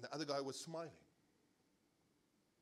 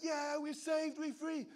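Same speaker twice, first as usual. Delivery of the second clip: Israelites cheering; 11 semitones higher, 5 dB louder, slower than usual. Retold or imitated.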